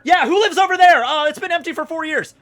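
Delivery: high, loud jittery blurt; nervous answer. Retold or imitated. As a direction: squeak it out in a panic. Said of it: "I was so fucking nervous".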